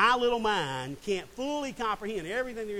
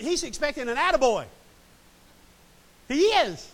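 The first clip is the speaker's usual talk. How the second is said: louder and higher, driving it home; building emphatic; 7 semitones higher, 9 dB louder than usual. Real, not acted. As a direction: push it up to a punchline. Preacher mocks Peter's expectation.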